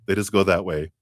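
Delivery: ordinary talk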